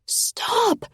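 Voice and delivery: high strained rasp; tearful rasp. A narrator's reading. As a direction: rasp it, near tears. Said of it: "I rasp".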